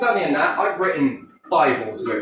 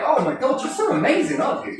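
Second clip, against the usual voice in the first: mock admiring crowd; 8 semitones higher, faster than usual. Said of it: "everyone will be looking at you like".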